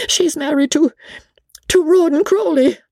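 very high, halting confession; timid companion dreading the reaction; nervous faltering. A narrator's reading. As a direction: stall on the name, then let it out high. Briggs stammers out the dreaded name.

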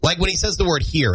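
ordinary delivery

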